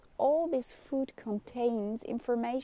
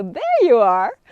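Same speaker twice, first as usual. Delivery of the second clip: delighted surprise; 12 dB louder than usual. Real, not acted. Friend appears in the chat.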